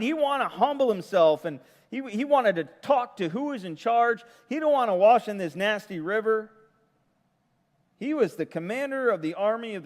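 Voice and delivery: high, loud, fervent sermon climax; preaching at full force. Real, not acted. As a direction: drive the point home with rising fervor.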